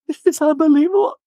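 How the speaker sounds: high, hammed-up disbelief; mock outrage. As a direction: play up Alexis losing it. Retelling Alexis' outburst.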